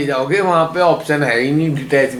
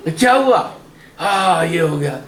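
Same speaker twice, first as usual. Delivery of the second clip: mock outcry; 2 semitones higher, slower than usual. Devotee crying out as the process begins, mock outcry. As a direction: imitate someone starting to wail in alarm. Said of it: "उधर चिल्लाना शुरू हो गए".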